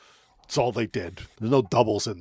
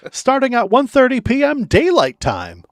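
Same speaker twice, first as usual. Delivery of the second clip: much higher, louder vintage radio announcer; old-timey announcer; 13 semitones higher, 7 dB louder than usual. Pretend. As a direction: read it like a 1930s newsreel. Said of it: "reading this like it's fucking old-timey baseball".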